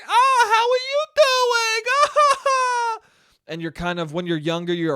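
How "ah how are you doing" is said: very high, loud, slow drawn-out greeting; loud aunt greeting. Retold or imitated. Imitating his loud aunt greeting a nephew, very high. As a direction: holler a greeting like a frightening aunt. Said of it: "Very loud, very wide-eyed".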